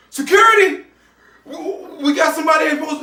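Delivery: a chef shouting for security in alarm; calling for security. Retold or imitated. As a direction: yell for the guards, stammering.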